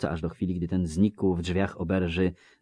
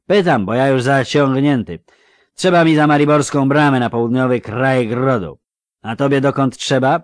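loud, higher, slurring tipsy villager; drunk Melfi; 7 semitones higher, 13 dB louder than usual. Acted. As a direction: call out like a cheery drunk. Melfi asks. (pijany Melfi, głośno i bełkotliwie)

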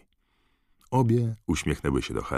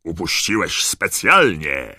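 loud, slow, thundering reproach; angry father thundering; thundering accusation; 3 semitones higher, 8 dB louder, slower than usual. A narrator's reading. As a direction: boom the accusation out slowly. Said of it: "zagrzmiał pan Weasley".

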